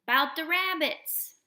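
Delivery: slow, high and loud burst of excitement; Lenny almost shouting about the rabbits; near shout. A narrator's reading. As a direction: nearly shout it out. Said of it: "Lenny almost shouted".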